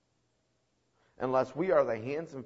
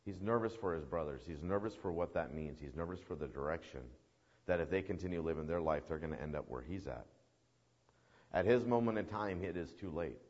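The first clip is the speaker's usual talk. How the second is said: lower, softer sombre preaching; subdued, grave commentary; hushed explanation; 6 semitones lower, 7 dB quieter than usual. Real, not acted. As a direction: let the voice sink as the fate is explained. Sombre aside on the doomed man.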